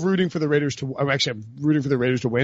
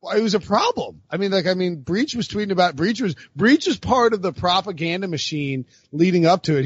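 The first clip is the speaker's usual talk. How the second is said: ordinary talk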